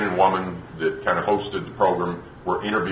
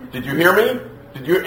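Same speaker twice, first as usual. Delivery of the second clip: raised-pitch, nagging demand; mock parental warning; 9 semitones higher than usual. Parent warning a child who is not listening, mock parental warning. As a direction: imitate a parent demanding attention.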